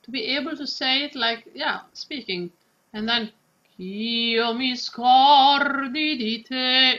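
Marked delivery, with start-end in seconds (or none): 3.8-7.0 slow, flowing, spoken line; legato. Shown as a demonstration. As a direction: let the words flow like speech. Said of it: "in the flow".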